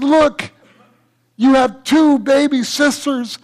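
high, slowed, proud reveal; excited father. Retold or imitated. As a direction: show off the babies with delight. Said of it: "And he said".

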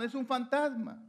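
high-pitched imitation of startled men; mock fright. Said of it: "Los apóstoles se asustaron".

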